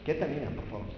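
a low, soft hush; calm her down with a hushed word; quiet warning. Pretend. Preacher hushes a fidgety girl, softly warning.

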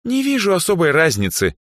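high, dismissive scoff; lightly mocking, high girl's voice. A narrator's reading. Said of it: "хмыкнула Даяна".

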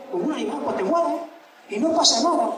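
normal talking voice

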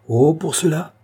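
outraged exclamation; exclaiming. A narrator's reading. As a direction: burst out in refusal. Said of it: "s'écria le roi".

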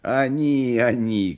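high bleating goat voice; satyr. Acted. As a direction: sing it out like a goat. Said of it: "козлиным голосом запел".

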